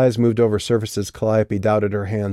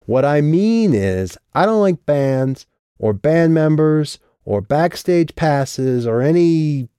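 emotional outburst; 7 semitones higher, 4 dB louder, slower than usual. Acted. Drunk Lauren, lips trembling.

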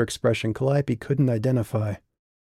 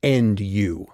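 bitten-off threat; much slower than usual. Acted. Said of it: "Calliope bit off her words".

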